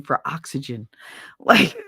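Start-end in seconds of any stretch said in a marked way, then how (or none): 0.9-1.9 laughing through the word; breaking into real laughter. Real, not acted. Laughing at ex-husband's tight jeans, real laughter.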